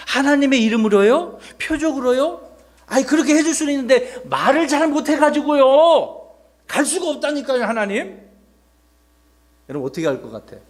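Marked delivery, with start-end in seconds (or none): 0.1-8.2 high-pitched, hammed-up protest; reluctant refusal. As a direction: argue back at God, pitch way up. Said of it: "모세는 또 거절했어요". (높은 목소리로 우기며 거절하는 연기)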